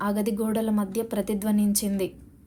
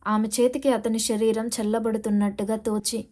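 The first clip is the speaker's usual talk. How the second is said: ordinary delivery, nothing marked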